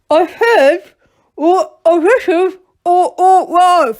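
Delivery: high, loud stammering bandit through a knife; spluttering around a knife in his mouth; impatient bandit. A narrator's reading. Bandit chief stammering at the gnomes, loud and high.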